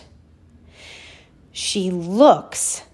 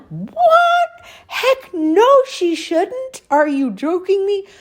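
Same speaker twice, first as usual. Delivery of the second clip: outraged disbelief; 14 semitones higher, slower than usual. Retold or imitated. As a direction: squeal it, scandalized. Outraged inner reaction to mom.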